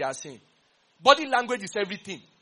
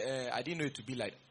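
mock excuse; 7 semitones lower, 9 dB quieter than usual. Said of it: "You are not gonna say".

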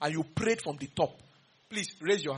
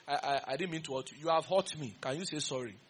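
softer, lower pleading imitation; mock whiny sorry; 5 semitones lower, 6 dB quieter than usual. Preacher mimics someone demanding an apology, soft and whiny. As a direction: whine it like a fake victim. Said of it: "anybody deceive you and say".

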